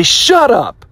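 sharp, high shout; firm shout. Shown as a demonstration. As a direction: shout the doubt down hard. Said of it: "stand up inside yourself sometimes and say".

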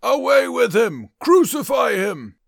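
loud, high, slow mob yell. Acted. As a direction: bellow it at the crowd's front. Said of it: "they yelled".

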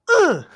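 loud high imitated yell of a kid; mock grunt. Tennis kids grunting outside the window, loud. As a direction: grunt like a player hitting the ball.

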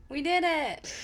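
high, loud triumphant cheer; excited cheer. Real, not acted. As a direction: cheer it like a win. Celebrating start of episode.

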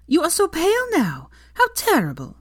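high, loud gushing greeting; excited Sophia. Acted. Sophia gushing at pale Hermione, high and loud. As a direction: gush over her like a long-lost friend.

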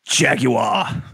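Jaguar shouted high in a hammed-up British accent, ending in jag-yoo-ah; mock British accent. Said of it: "in a bad British accent".

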